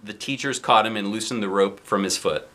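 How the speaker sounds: ordinary delivery, nothing marked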